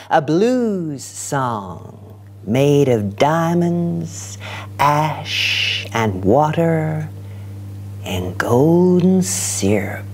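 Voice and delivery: slow, savoured poem reading; poetry reading. Acted. Poet closing her blues song poem, slow and savoured. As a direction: draw out each word of the closing list.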